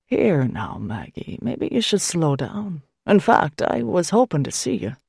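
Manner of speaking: lightly voiced kindly priest, calming; a hint of a patient older priest; gentle priest. A narrator's reading. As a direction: soothe her, settle her down. Priest's voice in dialogue.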